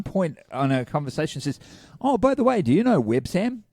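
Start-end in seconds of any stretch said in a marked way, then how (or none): 2.0-3.6 high, sing-song retold question; mock-innocent query, pitched well up; imitating her question. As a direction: put on her chatty, higher-pitched voice. Acts out woman's casual question.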